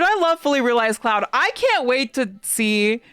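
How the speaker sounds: squealing fan delight; gushing fan. Real, not acted. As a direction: gush about your favourite hero. Gushing about the character.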